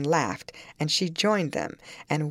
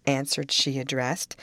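plain reading voice

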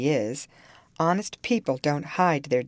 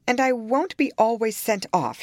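temper rising, slightly higher; kindling up; 8 semitones higher, 4 dB louder than usual. A narrator's reading. Young woman refusing to be sent away, mildly heated. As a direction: let a touch of indignation flare. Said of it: "kindling up".

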